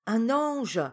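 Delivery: loud, high two-word relay; repeating for deaf ears. A narrator's reading. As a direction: call it into a deaf aunt's ear. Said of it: "lui dit celle-ci à l'oreille".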